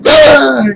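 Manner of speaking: high answering shriek; retold scared scream. Son screams back at dad on opening the door, scared. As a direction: scream back in mock fright. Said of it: "'cause I'm scared".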